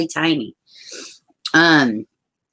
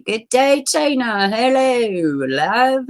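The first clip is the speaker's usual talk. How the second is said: high, lilting mock Southern Irish greeting; sing-song Southern Irish hello; Southern Irish accent; 7 semitones higher, much slower than usual. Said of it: "that's my Southern Irish accent".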